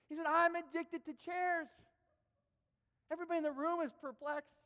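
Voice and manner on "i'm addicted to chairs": slow, high, loud declaration; dramatic confession. Retold or imitated. Preacher repeats his confession to a perplexed room, dramatically. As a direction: proclaim the absurd secret in full earnest.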